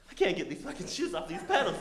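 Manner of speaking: high, rising panic; panicked realization. Retold or imitated. Cyclist stuck clipped in, in high panic. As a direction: blurt it as dread dawns.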